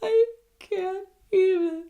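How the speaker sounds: loud, very high-pitched wail; hammed-up sobbing over a tiny kindness, ending in a gasp; mock crying. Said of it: "I would be crying at the slightest thing".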